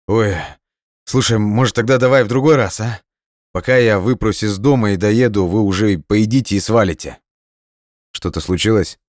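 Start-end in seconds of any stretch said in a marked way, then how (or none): 0.1-7.2 higher-pitched, casual buddy on the phone; carefree friend Igor. A narrator's reading. Friend phoning to back out of dinner, breezy and casual. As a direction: play the flaky pal making excuses.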